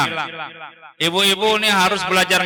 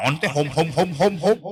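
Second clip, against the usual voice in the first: imitated child's echo; 4 semitones lower than usual. Teacher imitating children's muddled answer, low fumbling echo. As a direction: mimic a learner fumbling after the teacher.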